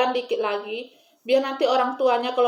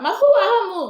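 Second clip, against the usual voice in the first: laughing, higher and louder Batak-style line; mock Batak accent; 5 semitones higher, 5 dB louder than usual. Joking to viewers about greeting ghosts, in mock Batak accent. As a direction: tease it out in a put-on Batak accent. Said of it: "aku pakai bahasa Batak".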